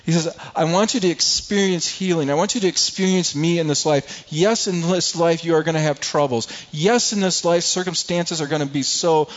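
preacher pleading with the congregation, growing fervent; impassioned preaching. Acted. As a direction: build the sermon to a fervent climax. Preaching builds to full force.

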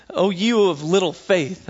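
slow, measured rebuke as Jesus; a gentle, slow chiding from the teacher; Jesus rebuking. Retold or imitated. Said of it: "Jesus says the words".

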